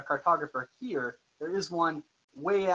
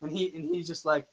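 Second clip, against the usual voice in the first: usual speaking voice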